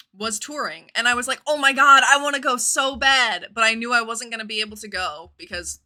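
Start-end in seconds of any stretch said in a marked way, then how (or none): 1.5-3.5 loud, high excited gushing; excited squeal. Retold or imitated. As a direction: gush like a desperate teen fan. Retold teen excitement.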